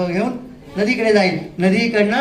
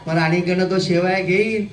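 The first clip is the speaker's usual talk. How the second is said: ordinary delivery, nothing marked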